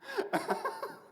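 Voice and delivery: high cackle. Acted. Wordless laugh after line.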